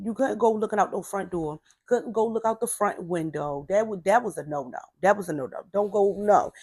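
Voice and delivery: ordinary talk, nothing marked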